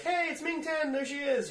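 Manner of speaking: high, bright greeting; cheery mock greeting. Band greeting their pregnant friend, high cheery mock. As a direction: sing out the welcome.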